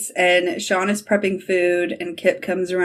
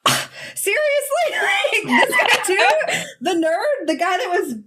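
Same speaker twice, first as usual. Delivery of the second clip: much higher, laughing, incredulous whine; mock exasperated disbelief; 9 semitones higher than usual. Actor retelling crew member's disbelief, high laughing incredulous whine. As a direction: squeal it out like you can't believe it, laughing.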